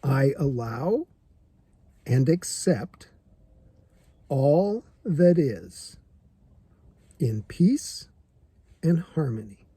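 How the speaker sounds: slow mantra, a long pause after each phrase. Shown as a demonstration. Reciting the mantra to viewers for the first time, slowly. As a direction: lay the words out one phrase at a time. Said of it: "Okay, here's the mantra".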